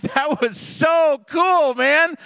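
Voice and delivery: a lightly voiced amazed grandpa; impressed inner voice. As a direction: marvel at it, slowly and high. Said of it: "and I thought".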